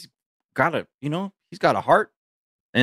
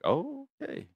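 mock surprise; 10 dB quieter than usual.

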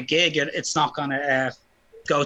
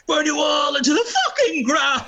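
voice cracking up an octave; 9 semitones higher than usual. Retold or imitated. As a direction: let the voice crack on the shout. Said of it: "But my voice cracked and it's like".

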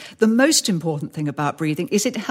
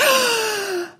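big gasp for air. Shown as a demonstration. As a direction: gulp air like a nervous speaker. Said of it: "like I'm doing just now".

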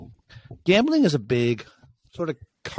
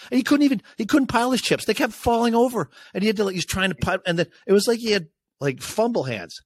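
high and fast, telling it with glee; animated storytelling; 8 semitones higher than usual. Real, not acted. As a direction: wound up, mocking the clumsy guy. Mocking the clumsy gambler.

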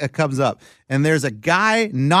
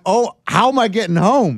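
mock panic; 6 semitones higher, 4 dB louder than usual. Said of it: "I'm like".

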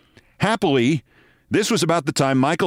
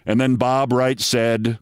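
normal talking voice

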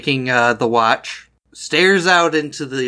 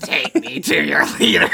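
alien voice. Pretend. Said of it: "Alien, right?".

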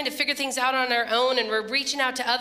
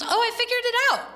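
excited imitation; 12 semitones higher, 3 dB louder, faster than usual. Andrew announces fixed heater.

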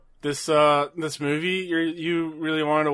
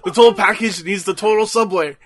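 wrestler promo voice; 6 semitones higher, 8 dB louder than usual. Pretend.

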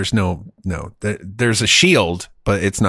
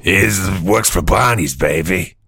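cool artist voice; 3 semitones lower than usual. Pretend. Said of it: "I'll be the artist".

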